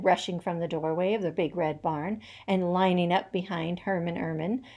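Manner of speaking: ordinary delivery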